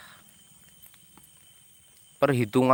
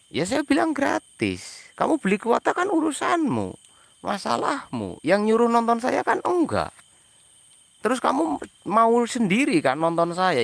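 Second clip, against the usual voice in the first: exasperated retort; 8 semitones higher than usual. Real, not acted. Annoyed retort to commenters.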